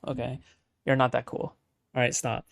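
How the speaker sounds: ordinary talk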